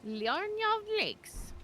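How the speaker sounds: put-on high, slow old lady voice; drawn-out granny voice, vaguely British; old lady accent. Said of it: "whenever I do an old lady accent".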